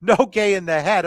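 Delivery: loud call breaking into a chuckle; real amusement bubbling through the commentary; amused laughing. Real, not acted. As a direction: call it while trying not to laugh. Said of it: "I'm kinda laughing".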